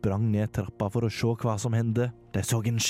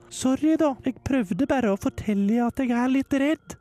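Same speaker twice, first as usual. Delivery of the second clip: put-on high girl voice, apologetic; Hilde's voice. Hilde admits to Stian she is scared, high voice. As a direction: sound a little scared and sorry. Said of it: "Hilde's line".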